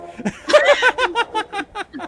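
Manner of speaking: high, loud cackling laugh; burst of laughter. Real, not acted. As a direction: crack up loudly at your own joke. Laughing at own political joke.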